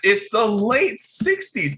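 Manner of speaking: high, slow, stretched-out insistence; exasperated insistence. Acted. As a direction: drag it out in exasperation. Pressed for the year, insists.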